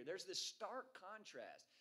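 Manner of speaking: ordinary talk, nothing marked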